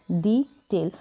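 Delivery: Detail as two pieces, डी and टेल; long "dee" at the start of detail; split into syllables. Shown as a demonstration. Teacher repeats for learners, detail in syllables. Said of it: "ई को हमने ई pronounce किया".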